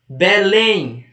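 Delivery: Belém said firmly, final lém stressed; stress on last syllable. Shown as a demonstration. Teacher asking which syllable is strongest, stress on lém in Belém. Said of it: "qual é a mais forte?".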